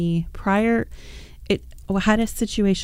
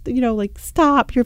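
high, louder plea to stop; pleading stop; 5 semitones higher, 3 dB louder than usual. Retold or imitated. Mom pleading with flailing daughter, higher and louder. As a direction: beg the child to stop, rising. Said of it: "I'm like".

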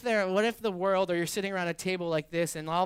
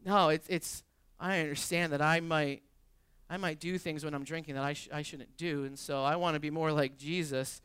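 ordinary talk, nothing marked

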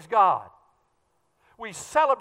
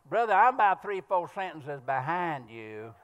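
put-on Texas accent; 5 semitones lower than usual. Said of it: "I was in Texas doing a men's retreat".

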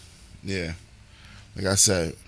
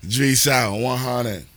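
loud sign-off; 2 semitones higher, 8 dB louder, slower than usual. Real, not acted.

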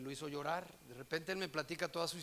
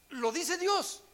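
hesitant; 14 semitones higher, 14 dB louder, much slower than usual. Retold or imitated. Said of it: "yo titubeando un poquito le dije".

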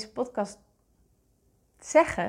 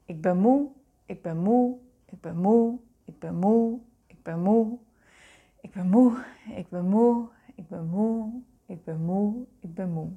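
growing weary. Shown as a demonstration. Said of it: "Zeg maar eens tien keer tegen jezelf".

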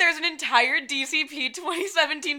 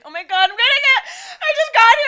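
high, loud panic through laughing sobs; retelling her panicked scream at costuming; mock panicked scream; 13 semitones higher, 11 dB louder than usual. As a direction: shriek it like your world just ended. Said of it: "like, screaming".

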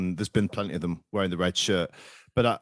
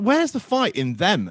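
higher, louder and slow; exasperated demand; 10 semitones higher, 4 dB louder, slower than usual. Retold or imitated. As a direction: drag out the disbelief. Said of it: "I was like".

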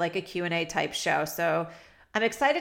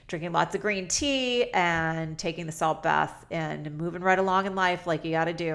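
usual speaking voice

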